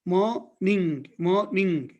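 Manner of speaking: "morning" slowly split as maw / ning; mor-ning division. Shown as a demonstration. Teacher demonstrating N in the onset, split mor-ning. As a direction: hold the open first syllable of "morning", N starts the second. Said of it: "in the onset of this syllable".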